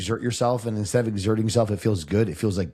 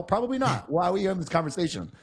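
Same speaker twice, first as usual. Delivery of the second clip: much higher flustered mom; mom's voice; 8 semitones higher than usual. Retold or imitated. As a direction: fret like a baffled mother.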